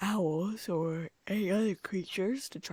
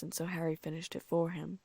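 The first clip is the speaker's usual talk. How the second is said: plain reading voice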